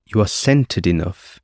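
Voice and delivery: usual speaking voice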